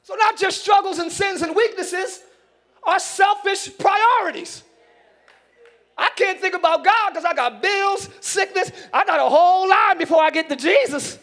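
loud, high preacher's cry; fiery preaching. Acted. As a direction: preach it to the congregation with fire. Preaching at full force.

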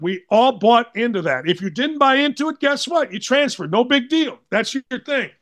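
ordinary delivery

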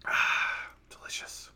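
refreshed exhale, then a whisper; hushed, savouring aside; whispered satisfaction. Real, not acted. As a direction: whisper it after a satisfying sip. Savouring a sip of beer.

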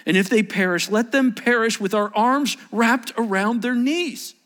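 high, loud exhortation; impassioned preaching. Acted.

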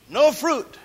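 usual speaking voice

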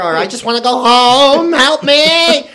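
high, loud drawn-out wail; hammed-up cry for rescue; mock desperate plea. Comedian acting out a plea to go home, mock desperate.

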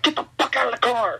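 high, fast mock yell of an angry cop; a mild mock cop yell, high and fast; retold cop yell. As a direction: bark it like a pissed-off cop, quickly. Angry cops ordering them out.

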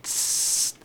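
short soft tss hiss; snake hiss. Shown as a demonstration. Recalling his S stutter, snake hiss. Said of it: "it was like a hissing snake".

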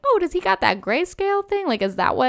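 very high-pitched retold question; mock puzzled surprise. Retelling her reaction to the helmet coming off, high and baffled.

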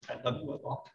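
a hushed, tender "I love you a lot"; tender whisper. Shown as a demonstration. Preacher softly whispering love to the congregation. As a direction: breathe it softly, almost whispered.

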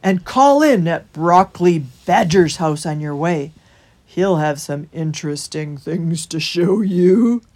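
loud, rolling character voice; advising hedgehog. A narrator's reading. Holgate directing Christopher to the badger's house, loud and rolling. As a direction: give directions in a big, drawn voice.

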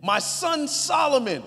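loud, high, slow preaching of the verses; emphatic scripture reading. Acted. Pastor reading King David's speech, loud and slow. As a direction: proclaim it like it ends in an exclamation point. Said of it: "I gotta say it with that, that emphasis".

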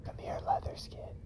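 soft breathy whisper. Acted. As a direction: whisper it close and low. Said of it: "whisper to Leatherskin".

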